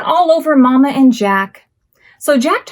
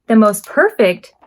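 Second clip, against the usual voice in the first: ordinary delivery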